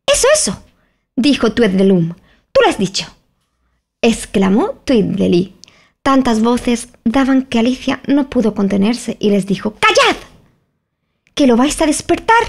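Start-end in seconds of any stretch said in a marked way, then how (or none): 0.1-0.6 very high, quick twin agreement; eager twin. Acted. Tweedledum backing his brother, very high and eager. 2.5-3.1 very high, quick twin agreement; eager twin. Acted. Tweedledum backing his brother, very high and eager. 9.8-12.4 urgent hush. Acted. Alice silencing the shouting twins, high and urgent.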